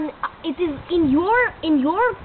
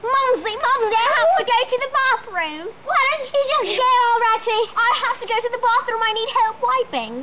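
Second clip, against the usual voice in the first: put-on high, whiny child with an Australian accent; hammed-up high Australian kid; 6 semitones higher than usual.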